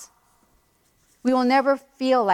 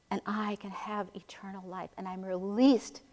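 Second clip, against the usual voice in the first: whisper; 9 dB quieter than usual. Real, not acted. Quiet personal devotional aside.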